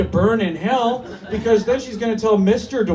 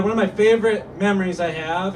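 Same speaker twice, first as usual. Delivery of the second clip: ordinary talk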